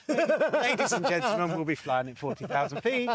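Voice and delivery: laughing into a pilot's cabin announcement; airline pilot announcement. Pretend.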